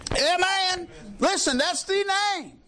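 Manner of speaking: high, ringing amen of joy; exultant preaching. Real, not acted. Preacher rejoicing over the name of Jesus, high and ringing. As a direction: lift the room with it.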